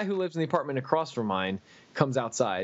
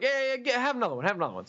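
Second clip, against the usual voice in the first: quick, pushy coaxing, pitched up at the start; eager dealer voice; 6 semitones higher, faster than usual. Retold or imitated. Alan urging the narrator to take more, mildly pushy. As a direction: coax him into another pill, lightly voiced.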